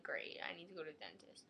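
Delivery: grim little whisper; whispered dread. Retold or imitated. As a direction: breathe it out with dread. Dreading dentist, muttered reply.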